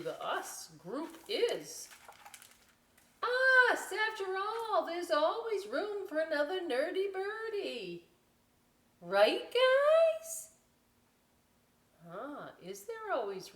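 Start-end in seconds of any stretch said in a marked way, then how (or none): 3.2-10.5 very high, loud welcoming squeak; eager nerdy birdie. Acted. Nerdy greeter welcoming the vulture, very high and loud. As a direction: gush it to the whole gang.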